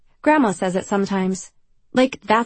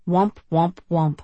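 sad trombone womp; 3 semitones lower, slower than usual. Acted.

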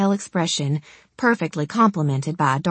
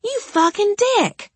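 much higher, furious insult; furious shriek. Acted. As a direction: spit it out in fury. Struggling against captor.

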